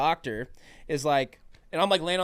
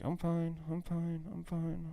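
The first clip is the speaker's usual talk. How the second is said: soft, quiet repeated reassurance to himself; quiet self-reassurance; 4 semitones higher, 9 dB quieter, slower than usual. Retold or imitated. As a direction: mumble it to yourself to stay calm. Nervously reassuring himself before blood draw.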